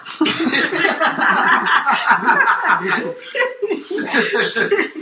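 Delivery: high, loud laughing fit; real burst of laughter. Real, not acted. Recalling his friend crying on TV, a real high loud laugh. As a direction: laugh hard at the memory.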